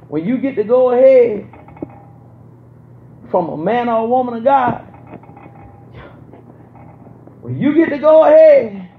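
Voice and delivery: loud, high, driving sermon build; preaching at full force. Acted. Preacher urging the congregation, loud and climbing. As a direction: lift the congregation with a rising preacher's call.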